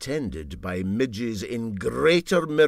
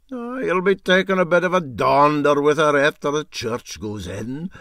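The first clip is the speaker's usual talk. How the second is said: louder, slowed sly teasing; teasing captain; 5 semitones higher, 8 dB louder than usual. Acted. Captain ribbing the engineer about the sister, lightly teasing. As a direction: savour each word to needle him.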